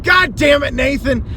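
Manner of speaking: high, loud mock curse; cursing the seller. Messaging the seller, a high loud mock curse. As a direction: yell the name in fake outrage. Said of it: "I wanted to message him".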